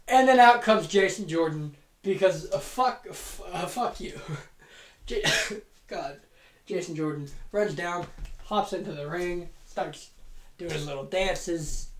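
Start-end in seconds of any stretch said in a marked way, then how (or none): none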